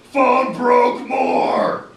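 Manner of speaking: very slow, high, very loud bellow; brutish roar. A narrator's reading. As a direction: roar it out word by word like a big brute. Brute Harry rages at phone.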